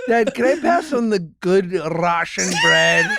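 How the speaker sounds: put-on slow, pleading kid voice through laughter; imitating son, laughing. Son asking his dad to skip the bread, laughing. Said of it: "Max goes".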